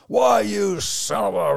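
half-acted fury, cut off mid-insult; mock angry outburst.

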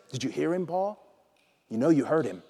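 suddenly soft, pointed question; hushed confrontation. Acted. Conscience pressing Paul, hushed and knowing. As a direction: drop to a quiet, knowing voice. Inner voice drops, pressing Saul.

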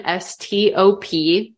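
letters spelled slowly, one by one. Shown as a demonstration. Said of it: "it is called the stop technique".

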